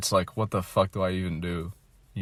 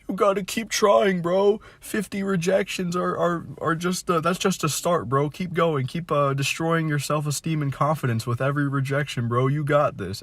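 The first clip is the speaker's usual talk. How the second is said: much higher, sarcastic bro-coach voice; mocking advice-giver; 8 semitones higher than usual. Vlogger mocks people urging him to keep trying, sarcastic high bro voice. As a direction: parody the cheerful "keep going bro" guy.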